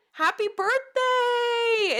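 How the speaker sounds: high, loud, drawn-out cheer; celebratory shout. Real, not acted. Streamer greets viewer's early birthday, high celebratory shout. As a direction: shout it out high, stretching the words.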